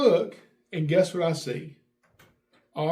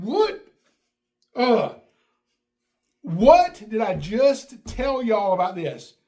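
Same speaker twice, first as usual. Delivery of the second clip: high, loud, slow disbelieving scold; exasperated scolding; 4 semitones higher, 6 dB louder, slower than usual. Retold or imitated. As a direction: drag it out in disbelief at the kids. Dad scolds girls again.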